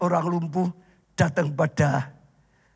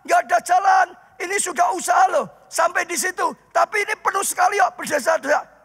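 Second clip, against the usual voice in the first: preaching at full force; 10 semitones higher than usual. Acted. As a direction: drive the story home to the congregation. Preacher builds the crowded house scene.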